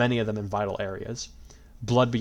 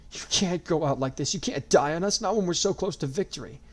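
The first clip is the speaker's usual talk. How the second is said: pleading; 8 semitones higher than usual. A narrator's reading. Pleading with dying friend.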